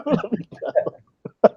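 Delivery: giggling let me go; laughing. Real, not acted. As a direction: let the laugh carry the words. Laughing at his own answer.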